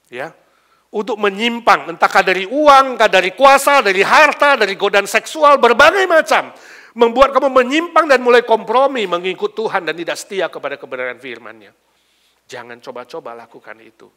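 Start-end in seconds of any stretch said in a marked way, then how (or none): 0.9-11.7 loud, high-pitched sermon warning; full-force preaching. Real, not acted. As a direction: thunder the warning to the congregation.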